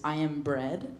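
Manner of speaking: low, soft, flat-voiced "I am bread"; quiet deadpan. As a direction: state "I am bread" softly and plainly. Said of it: "what it translates to is".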